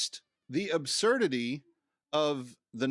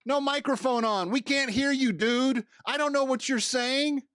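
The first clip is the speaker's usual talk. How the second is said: high, raised-voice mock heckle; pitched-up, exasperated calling out; exasperated heckling; 10 semitones higher, 4 dB louder than usual. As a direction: call out to someone who can't be heard. Mock heckling of unmiked [name].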